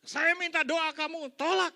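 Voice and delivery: high, loud indignant outburst; angry protest. Retold or imitated. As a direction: throw it back at him, offended. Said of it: "Dia marah-marah".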